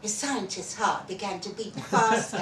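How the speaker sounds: ordinary delivery, nothing marked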